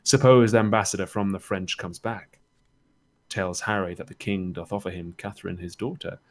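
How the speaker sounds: rousing, declamatory verse reading; Shakespearean Chorus. Acted. Chorus urging the audience to imagine the fleet, declaiming. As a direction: paint the scene for the audience, leaning into key words.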